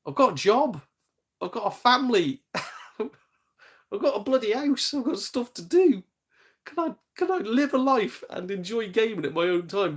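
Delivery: high-pitched, indignant list of grown-up duties; exasperated protest. Acted. Protesting to obsessive gamers that he has a life, exasperated. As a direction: plead your case, pitched well up.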